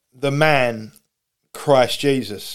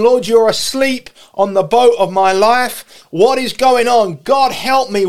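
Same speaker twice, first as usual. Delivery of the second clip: high, loud plea to God; acted frantic prayer, pitched high; desperate shouting; 7 semitones higher, 4 dB louder than usual. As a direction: cry out for help in rising panic. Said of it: "I was shouting and going".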